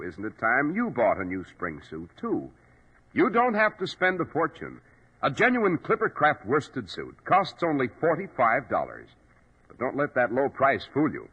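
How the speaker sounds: ordinary talk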